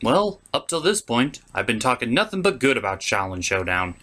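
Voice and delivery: usual speaking voice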